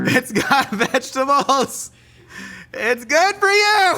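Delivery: high, slow, sing-song sales pitch; salesman pitch. Pretend. Pitching the burrito to viewers like an ad, high and slow. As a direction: sell it like a cheesy TV ad.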